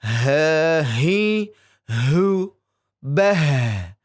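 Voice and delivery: "Ha, hi, hu, bah" with a strong breathy throat h; airy mid-throat ha chant; throat ha drill. Shown as a demonstration. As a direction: push air from the throat on "bah". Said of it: "kalau ha dari tengah tenggorokan".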